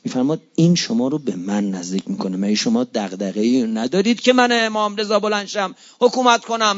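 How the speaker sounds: ordinary talk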